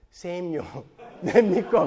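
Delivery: playing Judas as a Gyeongsang man with a laugh; Gyeongsang dialect. Judas asking Jesus at supper, in mock Gyeongsang dialect with a laugh. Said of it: "가롯 유다가 경상도 놈이라는 거예요".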